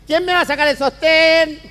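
high, slow shouted call; shouting to the crowd. Acted. Stripper shouts to the crowd, high and slow. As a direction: call it out to the whole room. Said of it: "grita para la galería".

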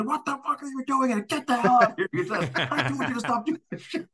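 high, laughing mock rage; retold boss bursting in, laughing mock yell; mock furious yell. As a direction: yell at him through laughter, high and hammed-up. Boss bursts in yelling at George.

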